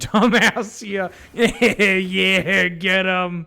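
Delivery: loud laughing cheer. Real, not acted.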